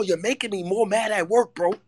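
normal talking voice

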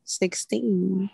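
unvoiced whispered echo. Real, not acted. Co-host echoing the number of years, whispering. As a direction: whisper it under your breath. Quietly echoes her friend's number.

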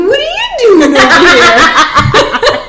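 slow, high, loud laughing question; laughing surprised call. Retold or imitated. Lady J finding the dog in the bathroom, laughing surprise. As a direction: call out amused.

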